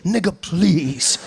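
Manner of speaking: very slow, drawn-out plea; a hint of a kneeling beggar; begging on knees. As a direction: stretch it out like a man on his knees. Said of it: "he got down on his knees and he said".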